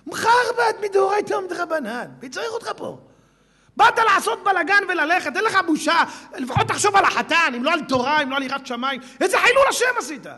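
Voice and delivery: loud, high rebuke; scolding shout. Retold or imitated. Preacher berates the wedding troublemaker, loud and high. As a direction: berate him without pause.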